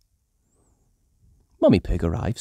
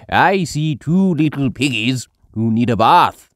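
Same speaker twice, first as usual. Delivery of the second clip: lightly voiced Grandpa Pig; 3 semitones higher, 6 dB louder than usual. A narrator's reading. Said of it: "says Grandpa Pig".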